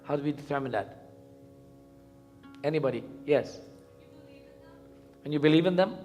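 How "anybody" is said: ordinary talk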